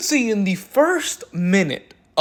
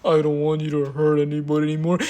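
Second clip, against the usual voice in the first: lower, feeble mock of a timid plea; weak timid voice; 5 semitones lower than usual. Mocking the man who begs her to stop, feeble voice. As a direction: play it small and powerless, mocking. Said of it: "with a one H P voice saying".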